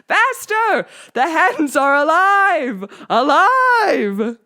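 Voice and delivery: high, loud, slow drawn-out scream; crazed teacher screaming; screaming at top of lungs. A narrator's reading. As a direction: howl it like a madman. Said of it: "screaming at the top of his lungs".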